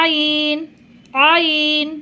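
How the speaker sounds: আইন squeezed from the middle of the throat; throaty Arabic ain. Shown as a demonstration. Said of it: "গলার ঠিক মধ্যখান থেকে উচ্চারণ করবেন".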